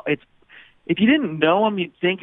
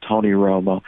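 calm Jim Nance voice; 7 semitones lower than usual. Retold or imitated. Comedian doing Jim Nance's calm phone greeting, low and hushed. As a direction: hush it down like a golf broadcast greeting. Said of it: "that master's voice, very calm".

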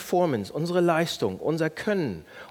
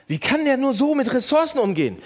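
louder, higher, contemptuous judgment; scornful complaint; 8 semitones higher, 4 dB louder than usual. Shown as a demonstration. Pastor acting out judging another driver. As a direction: sneer at the bad driver. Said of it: "Merkt ihr das?".

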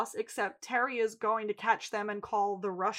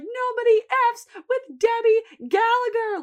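high, loud, drawn-out mimicked yell; raving girl; 12 semitones higher, 8 dB louder than usual. Host as Debbie bragging about the drowning, raving. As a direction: crow it slowly at the top of your voice. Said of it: "raving about the fact that she just drowned".

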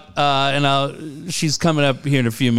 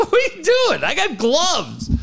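mock exasperated.

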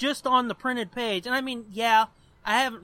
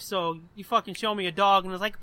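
ordinary delivery

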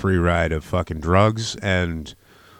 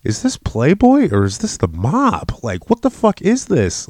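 higher and louder, outraged; outraged disbelief; 7 semitones higher, 8 dB louder than usual. Retold or imitated. Recalling watching the show, loud outraged disbelief. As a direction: exclaim it like you can't believe it. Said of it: "I was like".

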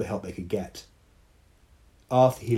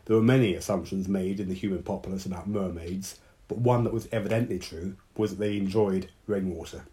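ordinary delivery

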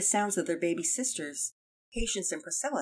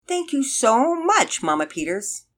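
high, loud, drawn-out enthusiastic gratitude; sweet, emphatic thanks; 7 semitones higher, 7 dB louder, slower than usual. A narrator's reading. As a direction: gush thanks pointedly so the children get the hint. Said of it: "a sweet, an enthusiastic, and an emphatic".

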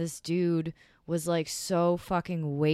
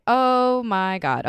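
slow, louder, higher exasperated exclamation; retold exasperation; 5 semitones higher, 7 dB louder, slower than usual. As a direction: drag it out in retold disbelief. Retold reaction to absurd rose line.

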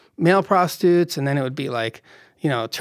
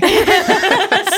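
much higher, louder burst of laughter; big laugh; 6 dB louder than usual. Real, not acted. Storyteller cracking up at the Robin Williams line, loud laugh.

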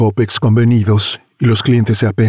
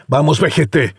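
put-on higher snapping at the old man; angry shout; 5 semitones higher than usual. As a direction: bark at the driver. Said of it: "grita enojado".

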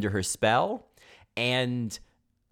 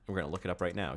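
ordinary delivery

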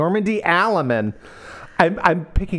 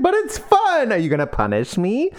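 coy tease; 7 semitones higher than usual. Retold or imitated. Heroine teases her captain.

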